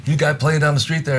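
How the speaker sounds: high, loud call of a club doorman. Retold or imitated. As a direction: hail him from across the room. Doorman calls out to him.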